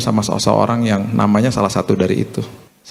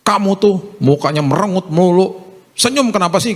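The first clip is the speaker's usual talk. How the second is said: higher-pitched mock nagging at a child; exasperated parent, raised pitch; imitated scolding father; 8 semitones higher than usual. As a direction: act out an irritated dad telling off his child. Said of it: "dia marah-marah sama anaknya".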